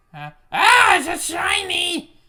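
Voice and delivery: high, loud excited yelling; excited shout. Real, not acted. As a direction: shout with delight at a find. Finds a shiny item, shouting.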